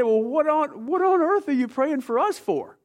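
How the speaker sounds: incredulous, voice flying up high; astonished question. Retold or imitated. Korean church leader to visiting pastor, baffled high-pitched question.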